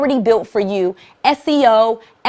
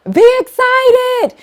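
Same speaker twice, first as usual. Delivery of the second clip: laughing, very high, drawn-out burst of excitement; excited; 7 dB louder, much slower than usual. Shown as a demonstration. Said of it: "and this is so important".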